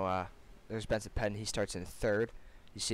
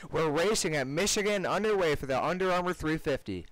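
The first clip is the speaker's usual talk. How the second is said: excited race call; 6 semitones higher, 3 dB louder than usual. Real, not acted. Race going green, commentator calls start.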